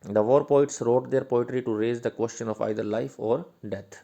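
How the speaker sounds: reading notes aloud slowly and loudly, word by word; slow dictation. Shown as a demonstration. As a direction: dictate it so students can write it down.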